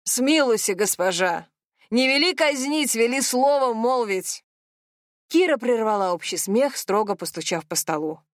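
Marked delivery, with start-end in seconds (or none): 0.0-4.4 hammed-up old-fashioned begging; theatrical mock plea to a queen; overacted plea. A narrator's reading. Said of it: "картинно упал перед Машей на колени".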